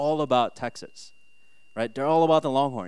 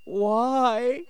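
high, mock-tearful kid; hammed-up high wail of a let-down child; child starting to cry; 9 semitones higher than usual. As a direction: imitate a small boy about to cry, pitched high. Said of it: "And he starts crying".

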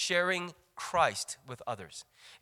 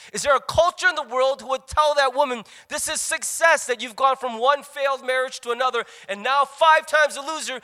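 forceful preaching; 6 semitones higher, 6 dB louder than usual. Real, not acted. Preacher's climax.

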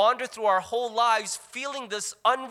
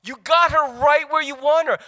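frantic; 7 semitones higher, 9 dB louder than usual. Retold or imitated. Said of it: "I'm like".

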